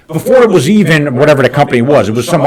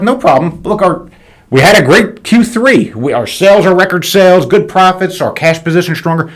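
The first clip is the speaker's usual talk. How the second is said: confident retold pitch; 6 semitones higher than usual. Owner retelling his pitch to the bank about record sales, emphatic. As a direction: boast the strong numbers, voice lifted.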